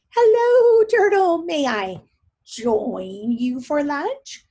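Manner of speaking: high, loud, sing-song hello; sweet spider greeting. Acted. Anansi calling down to Turtle for lunch, high and sweet. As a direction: call down sweetly, then slow the question.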